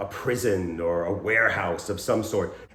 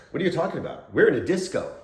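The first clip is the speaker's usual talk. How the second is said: higher-pitched, mildly baffled objection; mock disbelief; 6 semitones higher, faster than usual. Improviser blocking a scene partner's offer, mock disbelief. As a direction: scoff lightly in mock confusion. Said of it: "the other person doesn't go".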